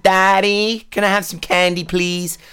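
put-on high, childish American twang; imitating American child accent. Dad imitating his little daughter's American accent. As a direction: mimic a four-year-old's American accent. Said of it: "starts talking in that accent".

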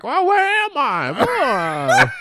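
high, slow, confused granny; dotty absent-minded old lady. Acted. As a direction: play the senile old woman. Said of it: "she has to play senile".

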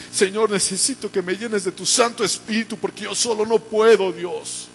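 high-pitched, urgent pleading prayer; impassioned prayer. Acted. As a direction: press each plea upward to God. Models prayer for congregation. (oración apasionada y urgente, en tono alto)

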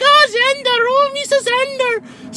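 put-on very high squeal of kids cheering; excited kids. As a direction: squeal it way up like schoolkids. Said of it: "the kids are like".

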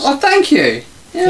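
mock flattered. Real, not acted.

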